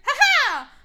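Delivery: loud, high gloating laugh; a hammed-up ha ha; triumphant laugh. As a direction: cackle in victory. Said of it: "she's like".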